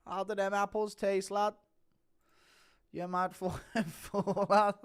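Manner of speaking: lightly voiced taunting fighter, laughing through it; Paddy taunting. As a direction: tease him like a cocky lad with a chuckle. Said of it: "Impression of Paddy laughing at Arman".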